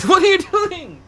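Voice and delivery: high, loud, drawn-out question through laughter; laughing squeal. Real, not acted. Laughing at cat's sudden antics.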